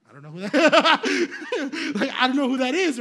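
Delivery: loud, high, laughing through the words; laughing denial. Retold or imitated. Storyteller quotes the barista to the crowd, laughing denial. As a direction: crack up while disowning her.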